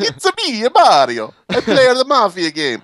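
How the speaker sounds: very high Italian cartoon voice; Mario. Both hosts doing Mario playing mafia, high Mario voice. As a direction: put on an exaggerated high Italian accent. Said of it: "we could just both do Mario".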